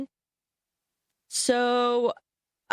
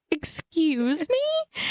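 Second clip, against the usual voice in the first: higher, slowly drawn-out excuse me; baffled excuse me; 7 semitones higher, much slower than usual. Retold or imitated. Reacting to girlfriend's strange quiz texts, drawn-out baffled. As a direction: stretch it out in total disbelief.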